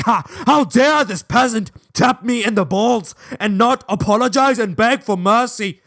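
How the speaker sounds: hammed-up affronted lord at a peasant; mock indignant freak-out. Mind freaking out over Fabian's tap, haughty mock outrage. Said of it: "my mind was just freaking out".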